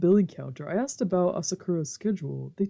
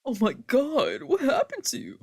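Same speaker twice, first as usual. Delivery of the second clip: drama queen; 8 semitones higher than usual. Acted. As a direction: gasp it like an overdramatic wife. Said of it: "my drama queen wife".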